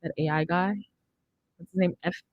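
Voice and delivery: normal talking voice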